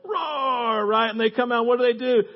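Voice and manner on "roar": high, drawn-out mock roar; put-on bear growl-roar. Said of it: "That's my best bear impersonation".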